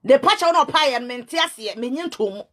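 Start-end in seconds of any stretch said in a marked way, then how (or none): 1.8-2.4 soft, muttered under the breath; a quiet aside before the outburst. Real, not acted. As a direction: pull the line down and away. Repeats phrase, first dropped low.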